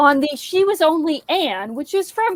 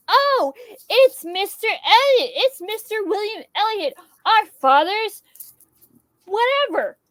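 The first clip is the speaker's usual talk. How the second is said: gushing Mary; 7 semitones higher than usual. Retold or imitated. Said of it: "It was Mary that was like".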